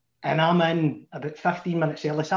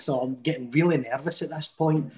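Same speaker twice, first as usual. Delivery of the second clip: ordinary talk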